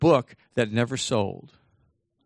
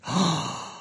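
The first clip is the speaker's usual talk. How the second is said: sharp mock gasp of amazement; theatrical intake of breath; awed gasp; 5 semitones higher than usual. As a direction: gasp as if starstruck by yourself. Gasps at own reflection.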